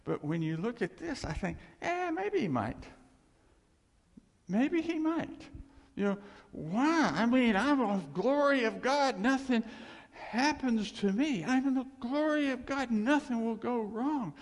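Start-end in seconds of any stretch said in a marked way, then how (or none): 1.8-5.4 high, wavering mock doubt; mock doubtful voice. Preacher mocking a doubting believer, high and unsure. 5.9-14.3 mock smug believer. Preacher mocking a smug believer, high and loud.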